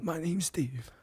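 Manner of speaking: whispered, humble reply to royalty; meek little whisper; timid whisper. Acted. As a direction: answer the monarch in a hushed whisper. Timid cook answering the Queen.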